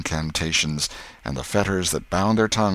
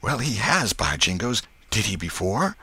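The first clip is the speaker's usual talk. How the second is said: put-on raised-pitch boy, astonished; Huck's joyful surprise; 5 semitones higher than usual. Huck looks out with joy, astonished. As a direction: marvel high, then settle into a question.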